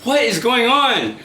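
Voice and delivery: mildly hammed-up disbelief; baffled, not mad. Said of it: "She wasn't mad".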